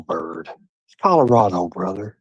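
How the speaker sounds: ordinary talk, nothing marked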